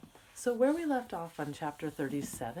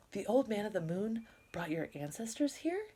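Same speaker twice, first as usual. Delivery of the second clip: surprised question; 7 semitones higher than usual. Acted. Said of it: "Minli asked".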